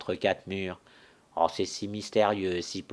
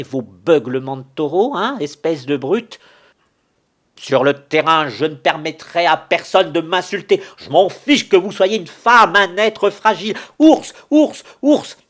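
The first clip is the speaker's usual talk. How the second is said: much higher, very loud fury; furious shouting; 7 semitones higher, 11 dB louder than usual. Acted. Challenger and widow shout insults over a duel. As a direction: hurl the words at full volume.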